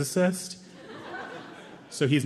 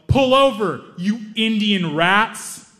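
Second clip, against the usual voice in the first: higher, louder, drawn-out mock yell; yelled insult; 7 semitones higher, 6 dB louder, much slower than usual. Angry driver yelling out his window at them, loud. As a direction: bark the slur out the car window. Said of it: "he yelled".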